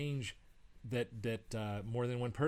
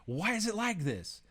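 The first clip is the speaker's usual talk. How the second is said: raised, much higher protest; retold frustrated yell; 10 semitones higher, 4 dB louder than usual. Frustrated student to the instructor, yelling. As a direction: cry it out as if fed up, high and a bit louder. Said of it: "I was just yelling".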